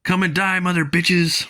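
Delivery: hammed-up war cry; battle taunt. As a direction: taunt them into the fight. Fighter taunts the attackers.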